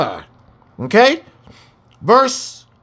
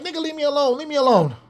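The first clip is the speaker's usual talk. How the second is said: paraphrased woman; 8 semitones higher, 3 dB quieter, faster than usual. Retold or imitated. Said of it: "She's like".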